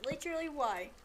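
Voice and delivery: ordinary talk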